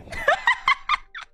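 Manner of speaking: high shrieking burst of laughter. Real, not acted. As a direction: squeal with laughter. Reacting to animal noises clip.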